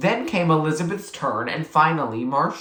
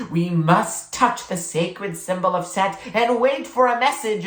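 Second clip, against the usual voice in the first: grave, higher ceremonial voice; oracle pronouncement; 5 semitones higher than usual. A narrator's reading. April laying down the ritual rules as an oracle. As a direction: speak as the gods' messenger.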